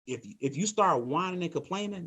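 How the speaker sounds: ordinary delivery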